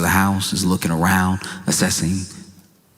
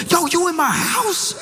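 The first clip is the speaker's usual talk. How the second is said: much higher, indignant acted question; hammed-up outrage, pitched way up; mock confrontational challenge. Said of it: "Bass in voice, ready to beat somebody down".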